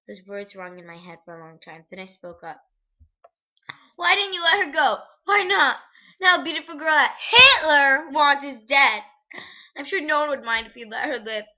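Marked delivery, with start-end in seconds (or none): 4.0-11.5 angry demand. Acted. Confronting the soldier.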